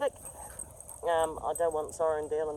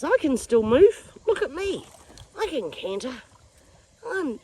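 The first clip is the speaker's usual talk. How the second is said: put-on boasting old horse; 8 semitones higher, 5 dB louder than usual. Owner voices old Hudson showing off, high boasting. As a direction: call out proudly as a horse still able to run. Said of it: "Oh, Hudson says".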